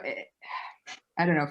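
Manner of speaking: usual speaking voice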